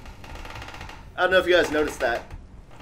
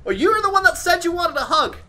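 mock indignant; 12 semitones higher, 6 dB louder than usual. Real, not acted. Ghost girl runs from offered hug.

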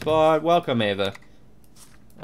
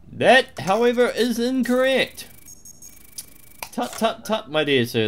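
prissy scolding; 10 semitones higher than usual. Pretend. Guard tut-tutting a traveller, prissy and high. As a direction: tut at him like a fussy clerk.

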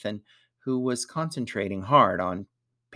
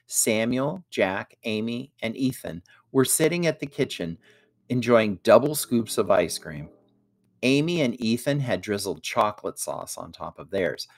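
plain reading voice

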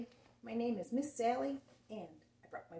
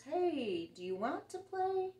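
much higher, bright-eyed invitation; friendly brother invitation; 9 semitones higher, slower than usual. Acted. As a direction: offer it like a kid making up.

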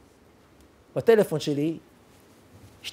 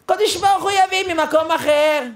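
רב חיים קניבסקי; 8 semitones higher than usual. Retold or imitated. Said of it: "אומר רב חיים קניבסקי בזה הלשון".

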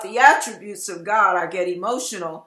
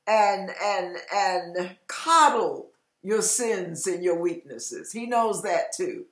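usual speaking voice

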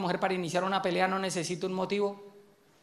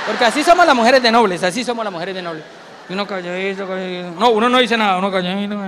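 loud, self-righteous wife; smug wife; 7 dB louder than usual. Acted. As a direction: boast of being the noble one. Esposa presumida de noble. (esposa orgullosa de ser noble, algo más fuerte)

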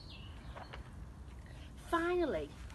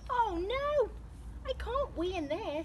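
high-pitched alarmed objection; dismayed protest; 10 semitones higher than usual. Acted. Alan facing the tiny toilet, high and dismayed.

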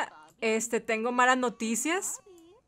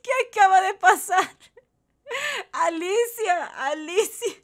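high, loud squeal through laughter; cracking up in disbelief; helpless laughter; 4 semitones higher, 4 dB louder than usual. Real, not acted. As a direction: gasp it out mid laughing fit. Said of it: "de tanto estar riendo".

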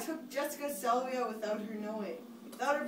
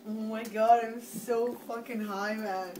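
normal talking voice

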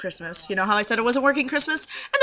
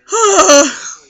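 laughing sigh; 8 semitones higher, 10 dB louder than usual. Real, not acted. Exhausted by her job.

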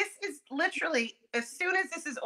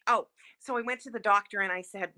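ordinary talk, nothing marked

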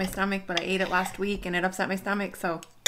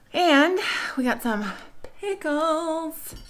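slow, high, drawn-out announcement; sing-song announcing; 9 semitones higher, 4 dB louder, much slower than usual. Acted. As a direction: present the pickles like a treat. Announcing pickles playfully.

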